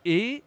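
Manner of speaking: E as a high, closed é with a rising pitch; sharp rising closed é on E; closed French é. Shown as a demonstration. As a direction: say E as "ay" without the glide, voice lifted. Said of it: "accent aigu, c'est E".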